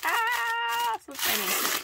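high, loud squeal of delight; excited squeal. Real, not acted. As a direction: squeal with real excitement as you open it. Squealing over opened package.

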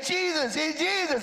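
acted calling from a crowd; blind man calling out. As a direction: cry out for attention. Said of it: "You remember blind Bartimaeus?".

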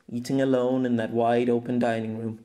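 usual speaking voice

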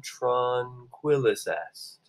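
hushed, drawn-out and soothing; very calm voice. Shown as a demonstration. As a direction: settle the room with slow, soft words. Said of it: "in a very calm voice".

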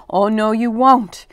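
ordinary delivery, nothing marked

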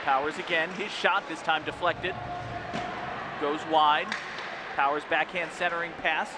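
play-by-play. Acted. Live play-by-play.

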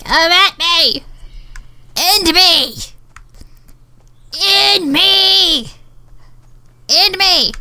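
high, drawn-out battle cry; taunting holler. Real, not acted. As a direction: dare the enemy with long yelled words. Daring hidden players to attack.

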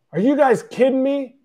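angry outburst. Retold or imitated. Player fuming after the game, angry high retort. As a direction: let the frustration jump out high. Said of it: "I'm so pissed".

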